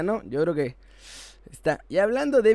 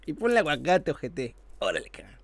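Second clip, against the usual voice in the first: lightly voiced laughing sheikh; mocking sheikh; 2 semitones higher than usual. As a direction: toss off the offer like pocket change. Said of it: "el jeque se va a reír así". (jeque burlón, a la ligera)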